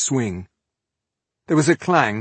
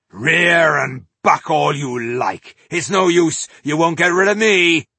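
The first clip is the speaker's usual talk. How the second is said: defiant shout; 6 semitones higher, 6 dB louder than usual. Acted. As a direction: roar it while hanging on. Dwarf clinging to bucking giant.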